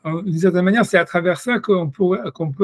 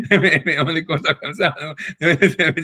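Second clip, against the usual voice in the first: laughing. Retold or imitated.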